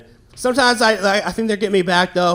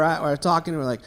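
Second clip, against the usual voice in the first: usual speaking voice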